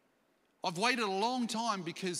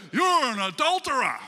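accusing shout; 5 semitones higher, 9 dB louder than usual. Acted. Preacher acting out how a prophet would not confront David, accusing shout. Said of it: "He comes and he doesn't go".

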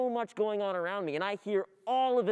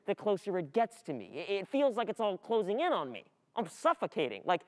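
ordinary talk, nothing marked

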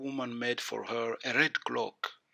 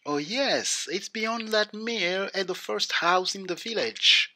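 lightly voiced little girl, higher; a higher, light child's part; 7 semitones higher than usual. A narrator's reading.

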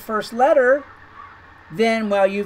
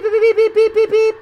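bleep imitation; 9 semitones higher than usual. Shown as a demonstration. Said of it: "I could go beep".

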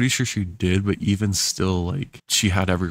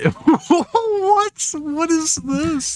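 laughing disbelief; much slower than usual. Real, not acted.

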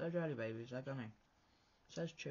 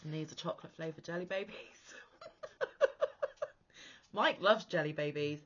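ordinary talk

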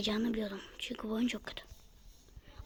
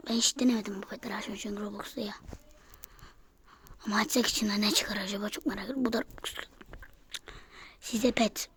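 ordinary talk, nothing marked

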